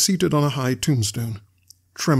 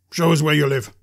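loud, menacing order; forceful, threatening command; convict's demand; 3 semitones higher, 5 dB louder than usual. A narrator's reading.

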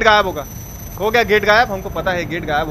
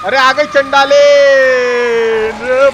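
loud, higher alarmed cry as she shows up; startled shout; 5 semitones higher, 10 dB louder than usual. Real, not acted. Gamer spots the witch enemy, startled loud shout. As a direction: yell out as the enemy appears.

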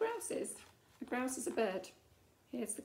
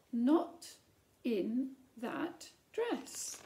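louder, very slow, drawn out; firm, loud refusal; 5 dB louder, much slower than usual. Acted. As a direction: land the final no like a gavel. Queen's repeated refusal, climax.

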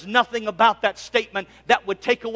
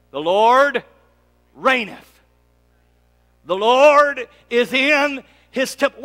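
a hint of the bishop's preaching, slow and louder; imitating the bishop; 3 semitones higher, 6 dB louder, slower than usual. Recalling the bishop's old sermon, lightly preached. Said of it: "Bishop preached something similar".

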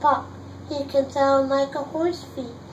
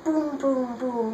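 ordinary delivery

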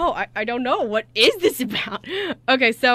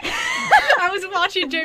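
high, loud laughing burst; words squeezed out through laughter; 10 semitones higher, 3 dB louder, slower than usual. Real, not acted. Watching Jamie's face at the twist, laughing hard.

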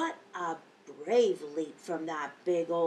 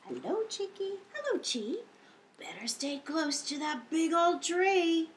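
put-on reading to children; 7 semitones higher than usual. A narrator's reading. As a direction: greet the monkeys gently, wide-eyed. Storyteller voicing monkey story.

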